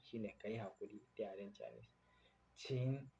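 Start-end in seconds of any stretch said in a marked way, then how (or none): 2.2-3.1 请 stretched long and slow; slow teaching 请; slow model word. Shown as a demonstration. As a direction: hold out 请 for the class to copy. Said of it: "shine please".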